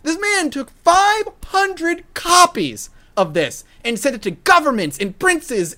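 high, loud disbelief at five; can't believe what he did; incredulous. Real, not acted. Incredulous about five hundred copies.

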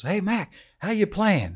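sportscaster hailing a golfer; cheery reporter. Retold or imitated.

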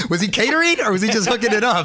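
asking through real laughter; laughing. Real, not acted. Laughing while asking the guest about the grill man. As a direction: laugh out the question to a friend.

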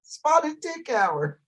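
slow, high posh British drawl; mock British. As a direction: stretch it out in a posh English voice. Mocking British phrases.

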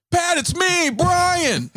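very high, shouted fan greeting; eager fan shout. Retold or imitated. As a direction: yell it like an overexcited fan.

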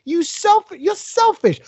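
high, slow, indignant mock outrage; upset outburst. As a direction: play the offended passenger, pitch up and drag it out. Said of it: "I was so upset 'cause I was like".

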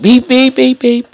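high, repeated alarm beeps; imitated vent beeping. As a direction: beep like a ventilator alarm. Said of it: "it starts beeping".

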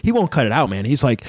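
ordinary talk

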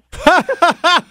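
loud real laughter; burst of laughter. Real, not acted. Caller laughing at his own story, loud laughter. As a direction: laugh out hard at the punchline.

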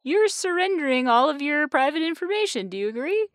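high-pitched voice of an app pop-up; pop-up notice. Acted. App pop-up asking users to surrender privacy, high-pitched notice voice. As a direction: read it out high, like an automated consent prompt. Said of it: "pop-up thing that is like".

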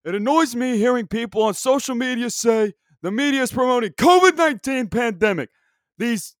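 put-on high, loud, hyped-up old sportscaster; Dick Vitale impression. Podcaster reads tweets to listeners, doing a hyped announcer voice. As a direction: read the tweet like a fired-up Brooklyn hoops announcer. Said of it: "This is my impression of Dick Vitale".

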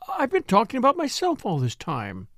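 sudden self-reproach, pitched high; exclaiming. A narrator's reading. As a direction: exclaim as you catch yourself, voice lifted. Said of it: "she exclaimed".

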